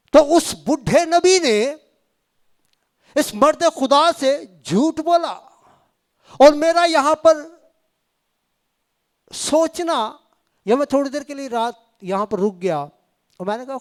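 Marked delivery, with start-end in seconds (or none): 0.1-10.2 high, loud, slow pulpit proclamation; preacher building intensity before the congregation; forceful preaching. Acted. Preacher booms the story of the old prophet, loud and slow.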